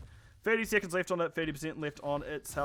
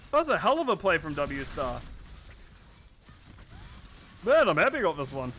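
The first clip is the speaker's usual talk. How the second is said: excited; 6 dB louder than usual. Real, not acted. Caster reacts to big play.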